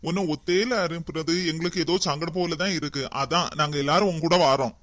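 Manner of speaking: mock sweet. Family says they'll come along.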